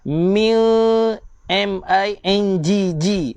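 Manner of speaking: MING drawn out slowly with a long nasal hum; nasal dengung demo. Shown as a demonstration. Quran teacher demonstrating dengung to students, slow and nasal. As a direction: hold the ng of "M I N G G" as a long nasal buzz.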